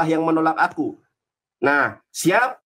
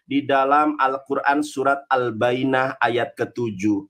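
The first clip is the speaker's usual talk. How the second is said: ordinary talk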